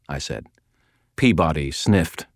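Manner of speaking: ordinary delivery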